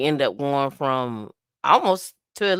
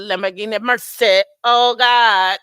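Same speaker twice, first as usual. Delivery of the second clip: loud, raised Jamaican dancehall shout; 4 semitones higher, 9 dB louder than usual. Acted. Host hyping a viewer's name, loud Jamaican dancehall call. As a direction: belt it like a Jamaican hype man. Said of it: "go into a Jamaican dance".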